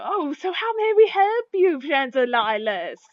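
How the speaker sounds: high, eager customer-service gush; excited shopkeepers. Acted. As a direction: leap up in pitch, eager to help. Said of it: "nod in excitement".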